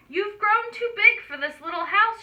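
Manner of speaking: mildly motherly scolding; mother pig. A narrator's reading. Mother pig voiced in story.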